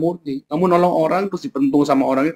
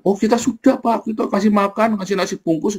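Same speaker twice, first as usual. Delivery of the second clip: eager church officer; 7 semitones higher than usual. Retold or imitated. Church officer voiced, higher.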